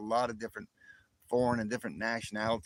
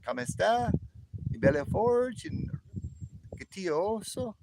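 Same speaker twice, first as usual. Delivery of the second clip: Spanish accent, pitched much higher; accento spagnolo; 10 semitones higher than usual. Shown as a demonstration. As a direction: show off your Spanish in a high-pitched greeting. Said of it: "and I learned Spanish".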